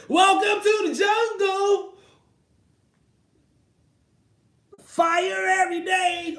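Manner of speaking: hype shout. Pretend. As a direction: bellow it like a ring announcer.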